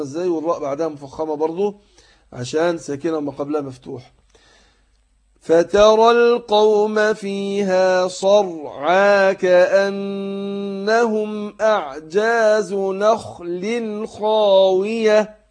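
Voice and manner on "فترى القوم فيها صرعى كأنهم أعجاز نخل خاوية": slow chanted tajweed recitation, heavy nasal hum; Quran recitation. Shown as a demonstration. Teacher demonstrating recitation to students, slow chant. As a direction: recite slowly, drawing out the ghunna.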